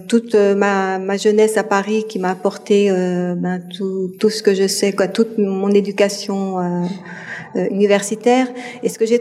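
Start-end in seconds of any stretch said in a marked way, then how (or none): none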